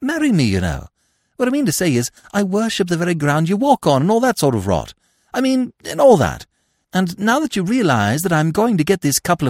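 high, rushing, breathless suitor; eager young toff. A narrator's reading. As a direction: gush it out like a lovesick twit. Voices Freddy in story.

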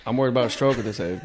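low, quick, muffled imitation; deadpan mocking mimicry; mimicking a guest. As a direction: parrot him low and soft. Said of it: "This is what you sound like".

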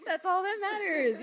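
high, loud, laughing agreement. Real, not acted. Wrestler agreeing with host about her height, laughing.

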